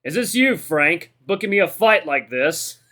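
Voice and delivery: big brash fighter heckling the camera; amused, incredulous fighter. A narrator's reading. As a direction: call out the culprit, loud and drawn out.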